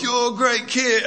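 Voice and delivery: put-on encouraging TV chef with a shaky British accent; Gordon Ramsay. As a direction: praise a child in a badly faked British accent. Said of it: "He judges like Gordon Ramsay on".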